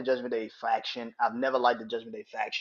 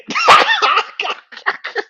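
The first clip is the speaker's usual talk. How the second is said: very high, loud cackle; real uncontrollable laughing fit; big laugh; 11 dB louder than usual. Real, not acted. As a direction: explode into laughter. Cracks up at the punchline.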